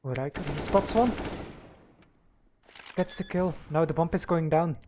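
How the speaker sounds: live play-by-play commentary; esports caster. Real, not acted. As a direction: call the match round by round.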